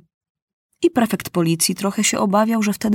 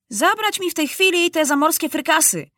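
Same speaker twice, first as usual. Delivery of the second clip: high, indignant command; imperious, high-pitched outburst; bellowed order; 11 semitones higher than usual. A narrator's reading. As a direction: order it taken away at once. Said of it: "huknął Maciuś".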